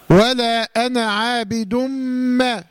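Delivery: slow chant, عابد ما merged as "aabidum-maa" with a nasal hum; recitation with ghunna. Shown as a demonstration. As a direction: recite ولا أنا عابد ما slowly, humming the doubled m. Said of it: "بغنة".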